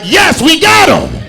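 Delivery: 2 high, loud and slow victory cry; 3 celebrate the catch out loud; 1 triumphant shout. Retold or imitated. 4 host hearing the trap snap, high triumphant shout. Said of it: "I'm like".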